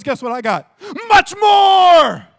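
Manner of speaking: loud and high, building to a huge shout; triumphant divine answer. Acted. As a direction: proclaim it as God's generous boast.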